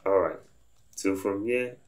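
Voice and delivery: usual speaking voice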